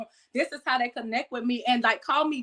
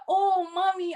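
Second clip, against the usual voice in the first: put-on higher, childlike excited call; little girl voice; 7 semitones higher, slower than usual. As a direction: play her seven-year-old self. Child self voiced in story.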